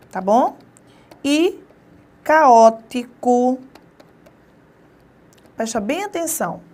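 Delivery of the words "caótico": "caótico" stretched very slowly so "ca-ó" stays apart; drawn-out pronunciation. Shown as a demonstration. Teacher showing students the hiatus, very slow and drawn out. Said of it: "Olha só".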